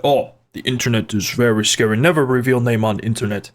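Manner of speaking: low mock-parent voice with dropped little words; warning parent. Retelling parents' warning about the internet, mock warning parent. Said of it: "my parents have been like".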